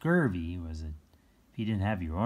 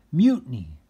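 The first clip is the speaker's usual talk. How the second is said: loud, higher cry of mutiny; pirate shout; 5 semitones higher, 10 dB louder than usual. Shown as a demonstration. Reader shouts a pirate word for the kids. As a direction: shout it like a sailor rising up.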